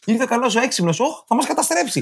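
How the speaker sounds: high, playful panic; playful high alarm; mock alarm. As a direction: act out fear of the alien, high. Playing out alien arrival.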